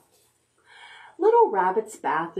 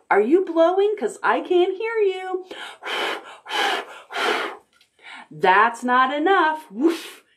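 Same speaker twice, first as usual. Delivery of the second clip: teasing encouragement; playful urging; 8 semitones higher than usual. Acted. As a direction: egg them on to blow harder. Urging child to blow.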